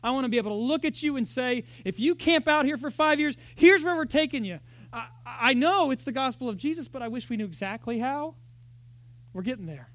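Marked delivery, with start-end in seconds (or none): 1.8-4.6 raised, louder pulpit voice; impassioned preaching. Real, not acted. Pastor telling church his heart, raised and passionate. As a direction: push it out with conviction.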